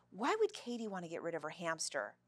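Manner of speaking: plain reading voice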